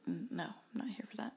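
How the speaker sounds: hushed, quick mock refusal; whispered refusal. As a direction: whisper it fast, turning it down. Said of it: "the other person is like".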